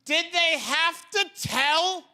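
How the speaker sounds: high, loud comic outrage; indignant disbelief at full voice; exasperated rant. Acted. Mock outraged rant about telling the gorilla.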